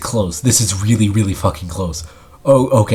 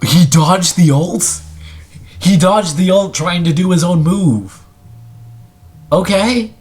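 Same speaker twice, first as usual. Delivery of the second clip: raised, incredulous reaction; astonished; 8 semitones higher than usual. Real, not acted. Commentator reacts to a dodged ult, astonished and high. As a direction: pitch climbing in disbelief.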